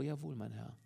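a strangled, soft yes; choked voice. A narrator's reading. Caught wife answers the commissioner, choked and soft. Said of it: "mit erstickter Stimme".

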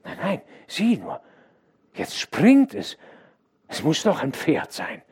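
put-on a boy unable to take his eyes off it; excited boy. A narrator's reading. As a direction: stable boy pointing out the ghostly horse to the servant. Boy excitedly watches the horse.

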